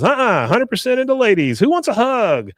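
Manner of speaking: high-pitched, hammed-up eagerness; acted over-keen appeal; eager insistence. Bill eagerly woos the ladies.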